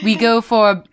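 hammed-up posh British voice; put-on British accent, lightly done; mock British accent. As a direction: read it like a posh English gent. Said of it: "if I didn't do the accent, I kept tripping".

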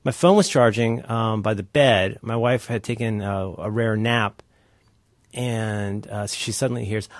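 normal talking voice

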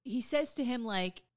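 ordinary talk, nothing marked

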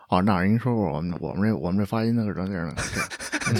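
low, mumbling imitation of Dabai. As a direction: lightly mimic a low-voiced friend. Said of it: "可能大白说的话就比较低沉".

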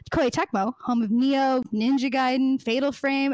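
ordinary talk

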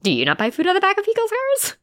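playfully disbelieving high question; mock incredulous. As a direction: act baffled that anyone wouldn't. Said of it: "And I'm like".

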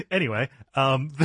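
ordinary delivery

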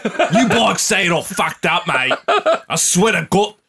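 put-on laughing, broad Kiwi accent. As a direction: lay the Kiwi accent on thick. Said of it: "I can't shake the Kiwi".

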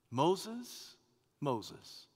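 soft call, dropping on the repeat; tender endearment. Acted. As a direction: say the name gently, like a friend. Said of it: "It communicates endearment, affection, friendship".

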